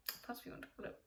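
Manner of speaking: a whispered afterthought to herself; whispered aside. Real, not acted. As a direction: drop to a whisper as if unsure of the term. Muttered aside after a term.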